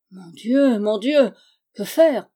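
loud, anguished lament; despairing cry. A narrator's reading. Stranger learning the girl is missing, in despair. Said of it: "le ton du plus navrant désespoir".

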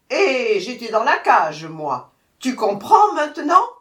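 loud, high, demanding; furious tiger. A narrator's reading. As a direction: hammer it out, demanding agreement. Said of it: "le tigre furieux".

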